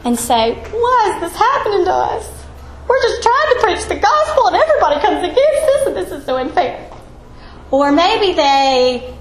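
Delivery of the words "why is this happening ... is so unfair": hammed-up whining, higher and half laughing; mock whiny complaint. Teacher mocks Paul and Silas complaining in jail, whiny and laughing. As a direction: play a self-pitying apostle, tongue in cheek. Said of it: "Paul and Silas cry and say".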